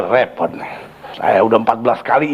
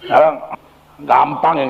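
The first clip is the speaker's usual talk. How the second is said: normal talking voice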